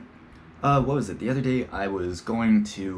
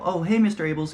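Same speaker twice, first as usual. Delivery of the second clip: cheerful greeting; 10 semitones higher, 4 dB louder than usual. Retold or imitated. Greeting teacher, high and bright.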